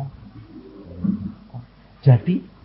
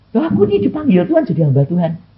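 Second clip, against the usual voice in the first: louder, fast pleading protest; mock protest; 4 semitones higher, 7 dB louder, faster than usual. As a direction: argue back quickly, insisting. Son protests to father. (protes cepat dan lebih keras)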